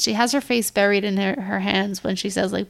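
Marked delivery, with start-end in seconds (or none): none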